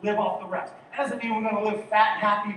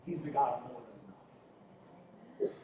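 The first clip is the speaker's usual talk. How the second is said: ordinary talk, nothing marked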